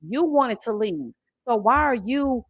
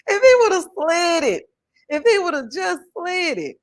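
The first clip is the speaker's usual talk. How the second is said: laughing; 8 semitones higher, 5 dB louder than usual. Real, not acted. Laughing while repeating comment.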